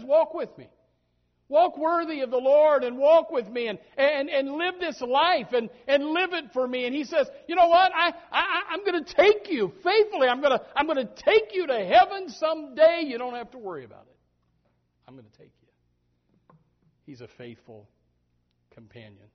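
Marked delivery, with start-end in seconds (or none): 0.0-6.9 loud, high preaching cry; fiery preacher plea; preaching at full force. Acted. Preacher voicing God's call. 7.5-14.2 loud, high preaching cry; fiery preacher plea; preaching at full force. Acted. Preacher voicing God's promise. 15.1-16.6 sudden hushed whisper. Acted. Sudden whisper after shouting.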